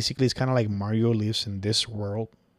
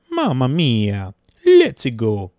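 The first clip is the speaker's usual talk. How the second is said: slow, louder mock Mario; Mario catchphrase; 3 semitones higher, 11 dB louder, much slower than usual. Quoting what Mario says in the games, mock Mario voice. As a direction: ham up the plumber's catchphrase a little. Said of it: "He just says".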